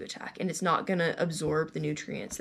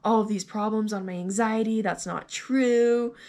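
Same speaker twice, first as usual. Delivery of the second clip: indignant protest, higher and pushed; retold anger; 4 semitones higher, 4 dB louder than usual. Patient protesting the anxiety diagnosis to doctor, retold anger. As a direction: re-enact being mad, pitch raised, hitting each accusation. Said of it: "I was so mad".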